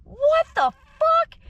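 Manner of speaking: shocked outburst. Retold or imitated. Hearing the cash price, a loud shrieking disbelief. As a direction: screech it in total disbelief. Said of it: "I said".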